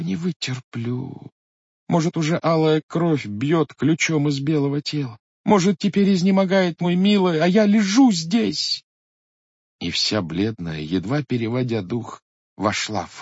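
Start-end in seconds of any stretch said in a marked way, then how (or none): 0.0-1.3 softer, slow, breaking repeat; wife giving up, murmuring slowly to herself; despairing whisper. Acted. Wife in anguish, hearing blows. 1.9-8.8 higher and higher, anxious; wife alone, imagining her husband dying, rising fear. Acted.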